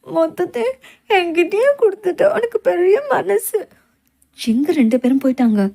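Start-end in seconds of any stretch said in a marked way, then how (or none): 0.0-3.6 higher, slow and heartfelt; moved gratitude. Acted. Friend thanks her for giving all the money, moved. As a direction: linger on the kindness, touched. Touched by generosity.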